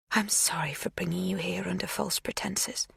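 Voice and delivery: hushed apologetic whisper. A narrator's reading. Magda whispering an apology to the girl. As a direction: apologise under the breath.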